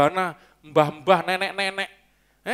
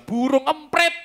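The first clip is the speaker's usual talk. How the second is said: high, loud exclamation; emphatic exclamation; 9 semitones higher, 4 dB louder, slower than usual. Real, not acted. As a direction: punch it out, pitched high. Exclaims the punch word of his point.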